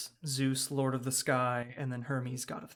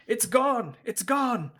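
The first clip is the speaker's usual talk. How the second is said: high, loud panicked cry of townsfolk; panicked wail; 9 semitones higher, 6 dB louder, slower than usual. Acted. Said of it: "you can hear them saying".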